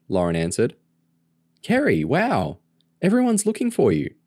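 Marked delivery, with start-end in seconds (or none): 1.6-4.1 high-pitched, amazed phone greeting; amazed and much higher; surprised excitement. Acted. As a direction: exclaim it high, thrilled to hear from a missing friend. Missing friend phones at last.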